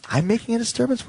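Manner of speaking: high, incredulous echo; indignant disbelief. Retold or imitated. Passenger scolded by stewardess for a disturbance, indignant disbelief. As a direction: throw it back as an offended question.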